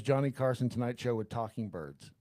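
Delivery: ordinary delivery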